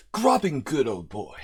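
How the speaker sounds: put-on slow, half-hearted stab at a British accent; mock British accent. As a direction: try a British accent for a laugh, slowly. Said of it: "I was joking about doing a British accent".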